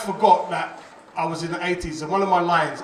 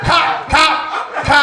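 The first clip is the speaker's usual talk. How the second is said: high, loud mock film-set call; urgent calling out; 10 semitones higher, 9 dB louder, much slower than usual. Film crew stopping the actor's take, loud high call. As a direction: call a halt at full voice, high.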